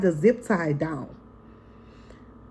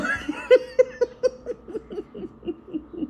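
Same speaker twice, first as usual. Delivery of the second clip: cackling fit that trails off; long high laugh; 13 semitones higher than usual. Real, not acted. Amused by husband's birthday slip, long laughing fit. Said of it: "I'm laughing out".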